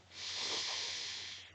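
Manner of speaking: a sharp unvoiced shh from behind a door; hushing shh. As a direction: hush them urgently. Someone hushes from inside diner.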